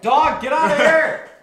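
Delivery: loud, high command at a pet; shouting at dog. Real, not acted. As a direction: yell to chase the dog out.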